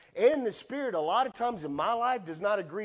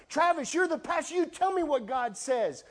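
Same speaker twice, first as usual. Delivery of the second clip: mock congregant; 9 semitones higher, 4 dB louder than usual. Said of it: "mock congregant insisting".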